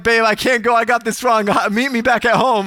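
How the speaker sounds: high, loud, flustered plea; hammed-up panic, lightly done; mock distress. Husband bails out on his wife after mistake, mock distress.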